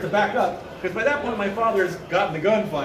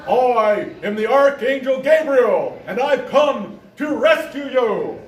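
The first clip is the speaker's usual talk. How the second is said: loud, high, slow proclamation; grand, drawn-out announcement of a divine rescue; self-proclaimed archangel; 3 semitones higher, 5 dB louder, slower than usual. Retold or imitated. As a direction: bellow it like a deranged heavenly messenger. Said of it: "He's like".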